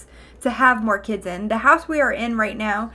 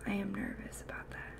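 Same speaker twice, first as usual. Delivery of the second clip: breathy whisper, much softer; whispered confession; 19 dB quieter, faster than usual. Real, not acted. Streamer whispers her exam nerves to chat. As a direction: let the nerves slip out in a whisper.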